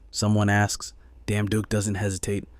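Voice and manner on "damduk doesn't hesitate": plain reading voice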